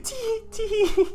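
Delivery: high, loud mock tittering; spelt-out giggle in a squeaky crazed voice; maniacal giggle. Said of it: "Begins to giggle louder".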